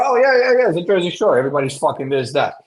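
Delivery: high, loud mock enthusiasm; sarcastic imitation of people hyping the Jersey Shore; mocking hype. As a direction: ham up the fake shore excitement. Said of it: "when it comes to the Jersey Shore".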